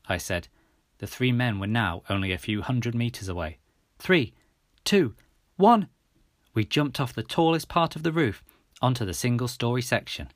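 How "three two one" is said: building countdown. A narrator's reading. Counting down before leaping.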